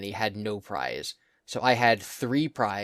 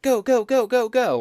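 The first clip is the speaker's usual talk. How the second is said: much higher and louder cheer; anime girl cheering; 12 semitones higher, 7 dB louder than usual. Retold or imitated. [name] mimics the claw machine cheering, high anime girl voice. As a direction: cheer the player on like a cute machine. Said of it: "she'll be like".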